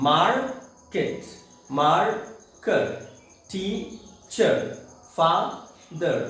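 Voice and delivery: "Market, marker, teacher, father", each slowly split in two with a pause; two syllables. Shown as a demonstration. Teacher giving examples of two-piece words, syllable by syllable. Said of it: "दो टुकड़े में टूट गया शब्द".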